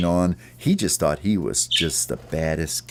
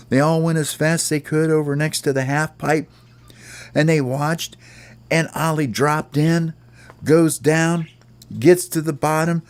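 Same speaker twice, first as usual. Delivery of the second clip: raised, eager storytelling of the stunt; a storyteller to listening children, with raised excitement; excited narration; 10 semitones higher than usual. A narrator's reading. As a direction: tell it like a thrilling trick. Excited play-by-play of the trick.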